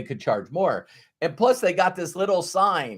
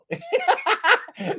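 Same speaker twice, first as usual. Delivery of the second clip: a burst of high laughter. Real, not acted. Laughing at his own punchline.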